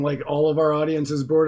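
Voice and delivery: usual speaking voice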